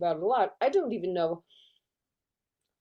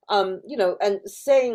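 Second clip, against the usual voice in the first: ordinary talk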